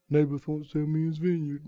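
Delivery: soft muffled whine; quiet pouting complaint; sulking husband. Acted. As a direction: whine into the pillow.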